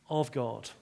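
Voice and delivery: normal talking voice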